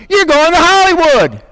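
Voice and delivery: high-pitched, excited mock announcement; imitated TV talent-show cheer; talent-show judge. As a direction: send a contestant through in triumph. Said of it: "like, America's Got Talent".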